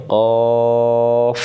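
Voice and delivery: slow heavy কফ from the tongue root; কফ said slowly with a thick throat sound; heavy back q. Shown as a demonstration. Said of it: "জিহ্বার গোড়া থেকে মোটা করে".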